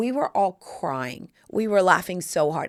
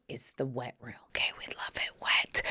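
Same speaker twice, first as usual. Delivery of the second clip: hushed, whispered aside; confidential whisper; 8 semitones lower, 8 dB quieter than usual. Pretend. Confiding in listeners about the wet room, playful whisper.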